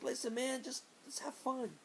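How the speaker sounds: high, easygoing imitation of a buddy; carefree Dwayne. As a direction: coax a friend in a high put-on voice. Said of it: "And he's like".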